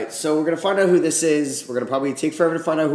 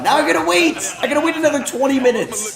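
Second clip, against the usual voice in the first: frustrated; 10 semitones higher, 5 dB louder than usual. Real, not acted. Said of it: "I'm pissed".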